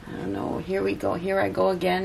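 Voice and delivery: usual speaking voice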